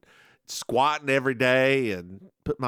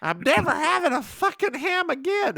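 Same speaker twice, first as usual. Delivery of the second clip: put-on high German-accented grandma voice; a mock little German accent; 12 semitones higher than usual. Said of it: "in her little German accent".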